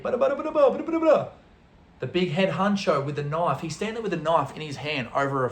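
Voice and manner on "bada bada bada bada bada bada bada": high-pitched, rapid nonsense syllables; mock Arabic chatter. Italian friend talking to the dealer in Arabic, mock babble. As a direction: imitate heated foreign chatter with babble. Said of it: "says something to him in Arabic".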